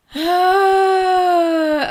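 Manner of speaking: tearful sigh. Real, not acted. Breaks down while reacting.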